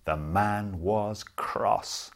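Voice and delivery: plain reading voice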